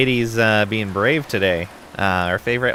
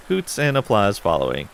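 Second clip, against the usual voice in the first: usual speaking voice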